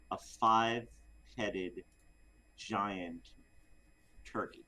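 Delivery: slow, pausing build to the punchline; comic slow reveal; dramatic pause reveal. Acted. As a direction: drag out each word for suspense.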